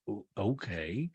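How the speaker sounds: low, soft, drawn-out okay; Sutton in disbelief. Retold or imitated. Housewife staring in disbelief, slow quiet imitation. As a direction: stretch it out quietly, as if stunned. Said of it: "Sutton is staring in disbelief".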